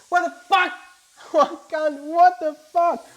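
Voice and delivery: high, loud shocked outburst. Retold or imitated. Reacting to the thought he cured himself, shocked and loud. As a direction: blurt it out in disbelief.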